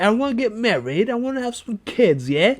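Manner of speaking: put-on Cockney accent; Tom Holland, Cockney. Co-host posing as British actor quitting Spider-Man, mock Cockney. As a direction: do a hammed-up Cockney. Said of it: "That was a good British Cockney accent".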